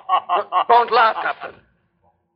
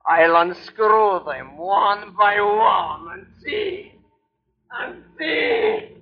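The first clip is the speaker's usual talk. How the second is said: slow manic muttering; 3 semitones higher, much slower than usual. Acted. Mate in madness.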